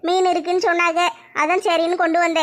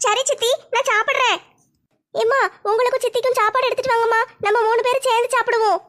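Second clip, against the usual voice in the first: put-on higher, girlish daughter asking her mother; young daughter voice; 5 semitones higher than usual. Daughter asks mother about the sofa, girlish higher voice. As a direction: play the curious teenage girl, pitched up.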